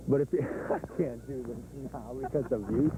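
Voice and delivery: words broken by a laugh; can't keep a straight face; laughing through. Real, not acted. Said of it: "The laugh".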